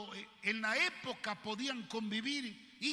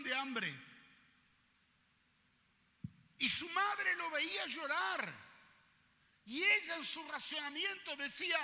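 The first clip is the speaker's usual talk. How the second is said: fiery preaching; 6 semitones higher than usual. Acted. Preacher crying out the story of the boy to the church, fiery. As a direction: preach it with rising fire.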